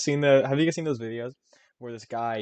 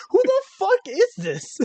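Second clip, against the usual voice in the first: much higher, slow, breaking into laughter; mocking a stunned barber customer while cracking up; laughing disbelief; 4 dB louder, slower than usual. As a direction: squeal it through a laugh. Same line, laughing.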